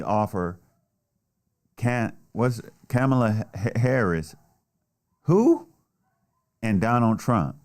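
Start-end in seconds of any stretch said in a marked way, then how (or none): 5.2-5.7 sudden high, loud who; a jump up into a mocking who; mock surprised squeak. Real, not acted. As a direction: feign not knowing her name. Mock confusion over name.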